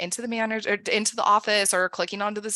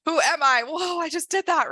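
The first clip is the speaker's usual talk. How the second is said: excited; 8 semitones higher, 4 dB louder than usual. Pretend. Amazed at own courage.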